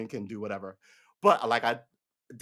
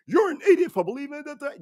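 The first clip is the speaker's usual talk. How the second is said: much higher and louder, hammed-up insult; mock scolding; 12 semitones higher, 7 dB louder than usual. Stranger scolds him, in a high, loud mock insult. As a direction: jab at him like a know-it-all. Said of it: "when you show up and go".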